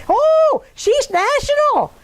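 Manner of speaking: very high, drawn-out mock awe; mock-impressed teasing. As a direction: tease with a loud, high "Oh". Teasing retold quote of Swami.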